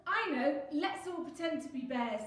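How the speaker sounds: loud, high squirrel enthusiasm; excited shout. A narrator's reading. Squirrel rallying friends to play bears, excited shout. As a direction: call out the bright idea loudly. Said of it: "shouted Squirrel".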